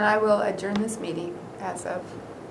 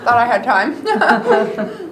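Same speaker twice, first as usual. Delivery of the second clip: laughing; 3 semitones higher, 6 dB louder, much slower than usual. Real, not acted. Laughs through chocolate mouthful.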